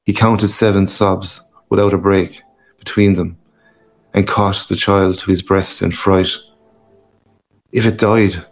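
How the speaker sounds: plain reading voice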